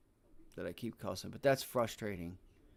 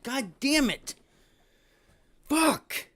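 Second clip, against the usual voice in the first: angry shout; 14 semitones higher, 8 dB louder, much slower than usual. Real, not acted. Rage after a missed entry.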